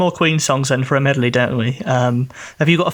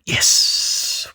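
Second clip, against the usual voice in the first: hushed, unvoiced celebration; whispered yes. Co-host echoing the win, whispered yes. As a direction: whisper a little cheer.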